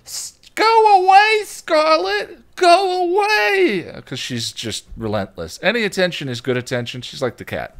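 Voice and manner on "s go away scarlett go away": put-on high, loud shrieking little boy; big brother screaming at his little sister; kid yelling. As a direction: yell it like a fed-up five-year-old. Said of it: "I heard just yelling from the kitchen".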